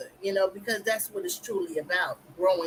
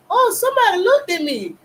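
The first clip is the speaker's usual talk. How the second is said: much higher and louder, squealing delight in a different voice; excited; 12 semitones higher, 11 dB louder than usual. Retold or imitated. Recalling her joy when a creator noticed her first video, high excited squeal. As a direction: replay the thrill of a first viewer noticing you. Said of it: "I got all excited".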